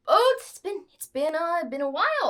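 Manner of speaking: a lightly voiced, fussy old clerk greeting; clerk's voice. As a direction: clerk greeting his returning employer. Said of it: "he's like".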